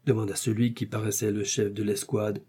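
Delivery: ordinary delivery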